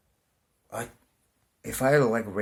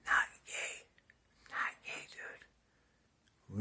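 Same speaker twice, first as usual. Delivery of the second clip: whispered aside; 11 dB quieter, much slower than usual. Real, not acted. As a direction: slip it in under your breath.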